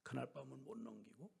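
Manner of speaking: whispered, sombre; hushed. Acted. Preacher telling of the sister's death, whispered. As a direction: drop to a whisper for her death. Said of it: "hushed tragic ending".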